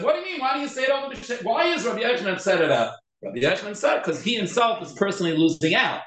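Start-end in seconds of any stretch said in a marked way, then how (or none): 0.0-1.4 lightly acted, high-pitched questioning; imitating everyone else. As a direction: mimic a puzzled objection, pitched well up. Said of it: "Everyone else just said".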